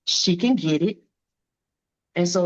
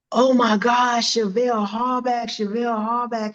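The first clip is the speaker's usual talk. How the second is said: starstruck surprise; 5 semitones higher than usual. Retold or imitated. Promoter recognizing the boxer on FaceTime, surprised. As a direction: gasp out the name in delight.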